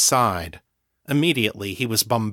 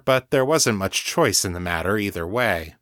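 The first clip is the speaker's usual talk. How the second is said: usual speaking voice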